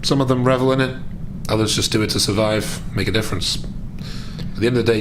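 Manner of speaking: ordinary talk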